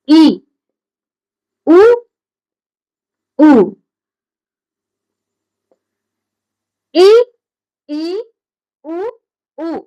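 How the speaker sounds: ई and उ as separate sounds, mouth barely open; narrow vowel demo. Shown as a demonstration. Teacher showing vowels made with a slightly open mouth, isolated demonstration. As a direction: sound out ई and उ one at a time. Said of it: "ई में हमने पूरा मुख खोला?".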